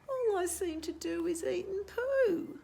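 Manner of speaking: high, plaintive bird whine; Cobber voice. Acted. Cobber repeats complaint.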